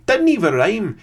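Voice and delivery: high, loud mock Scottish grumble; bad Scottish accent. Gamer continuing the sketch impression in mock Scottish accent. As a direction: keep the appalling Scottish accent going. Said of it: "that's an appalling Scottish accent".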